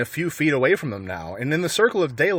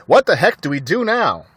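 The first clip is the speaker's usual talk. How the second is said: angry; 5 semitones higher, 6 dB louder than usual. A narrator's reading. Angry farmer.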